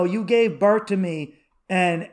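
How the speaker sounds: ordinary delivery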